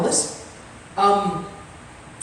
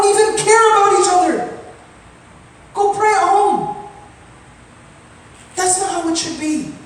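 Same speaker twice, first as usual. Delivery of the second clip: rising, much higher and louder preaching rebuke; impassioned rebuke; 6 semitones higher, 3 dB louder than usual. Real, not acted. Imam scolding congregation for neglecting newcomers, impassioned rebuke. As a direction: scold them hard, building to a cry.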